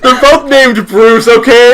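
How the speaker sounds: high, loud, laughing yell; loud defensive protest. Real, not acted. Defending a mix-up of two Bruces, loud high protest. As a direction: defend yourself at full volume.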